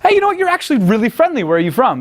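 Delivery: put-on high cheery compliment to a girl; bright high pickup line. Shown as a demonstration. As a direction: pitch way up and sell it. Demo line to woman, very high.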